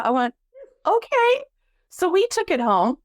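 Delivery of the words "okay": stunned okay. Retold or imitated. Stunned agreement to offer.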